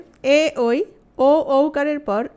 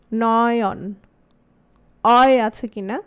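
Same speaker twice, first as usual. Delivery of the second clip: অয় sounded out loud and drawn; stress on অয়; 2 semitones lower, 4 dB louder, much slower than usual. Shown as a demonstration. Teacher to class, stressing অয় in Nayan. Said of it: "কি আসছে বলো তো?".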